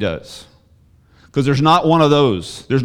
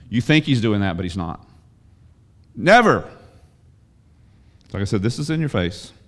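ordinary talk

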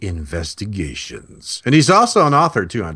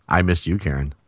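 normal talking voice

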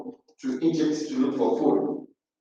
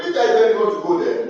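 quick nagging rebuke; 6 semitones higher, faster than usual. Acted.

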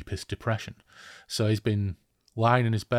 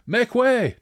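very high, loud yell; yelled command; 14 semitones higher, 7 dB louder than usual. Acted. Rider thundering through the enemy camp, yelling. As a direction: yell to clear the road. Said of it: "Deverk yelled".

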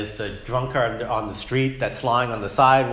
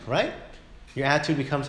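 normal talking voice

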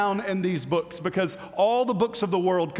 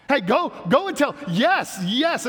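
eager urging; 5 semitones higher, 4 dB louder than usual. Retold or imitated. Imagined eager movement leader urging.

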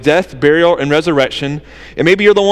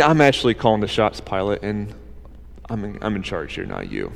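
soft, low and sure, fading away; calm quiet authority, dropping to almost nothing; 10 semitones lower, 9 dB quieter than usual. Retold or imitated. Jesus telling Pilate he is in charge, quietly.

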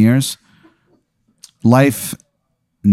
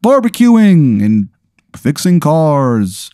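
pitched-up, solemn plea of a fading holiday spirit; weary Father's Day spirit; 7 semitones higher, slower than usual. Acted. Game master voicing the Father's Day spirit to Aaron, high and solemn. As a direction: sound like a father figure losing his powers.

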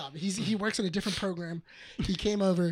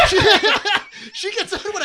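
laughing; 13 semitones higher, 11 dB louder than usual. Real, not acted. Laughing about girlfriend on rides.

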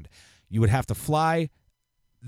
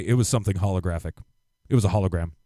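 mocking a flat-earther; 6 semitones lower, much faster than usual. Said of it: "they'll probably still say".

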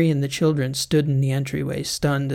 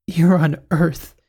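fighting back laughter; 3 semitones higher, 5 dB louder, slower than usual. A narrator's reading. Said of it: "fighting back laughter".

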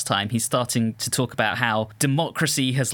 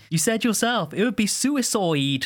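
accusatory villager; 8 semitones higher than usual. Retold or imitated. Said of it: "he has the best line reading".

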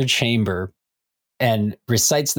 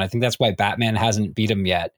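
ordinary talk

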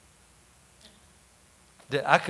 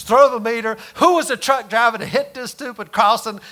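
raised, half-hammed angry rant; mock angry outburst; 6 semitones higher, 4 dB louder than usual. Coworker ranting about the truck, mildly angry. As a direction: play the mad coworker ranting. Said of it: "And Don got mad".

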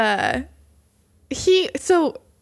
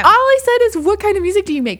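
higher, louder protesting tone; indignant; 10 semitones higher, 4 dB louder, faster than usual. Real, not acted. Protesting to her cohost that she never asked. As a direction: insist you did nothing wrong. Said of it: "I didn't".

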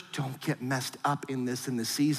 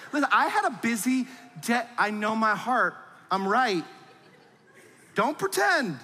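quick, pitched-up self-justifying; lightly voiced smug excuse; mock defensive; 5 semitones higher than usual. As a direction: insist you're right, a bit hammed. Defensive spouse reply.

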